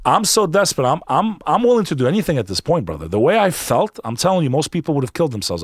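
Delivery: insistent retelling of being at the end of your rope; urgent desperation. Retold or imitated. Man telling his friend how desperate he felt, urgently.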